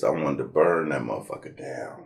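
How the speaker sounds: hushed, voiceless seething; angry whisper. Real, not acted. Recalling the lost contest, a hissed angry whisper. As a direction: breathe it out through clenched fury.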